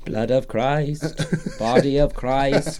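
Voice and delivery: slow, solemn priest intoning; mock priest. Mock church communion intoning.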